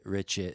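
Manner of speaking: "Richard" said as "Richit"; "Richard" with its D hardened to T; final D as T. Shown as a demonstration. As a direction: end "Richard" on a T with no R. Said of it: "turning D's at the end of names into T's".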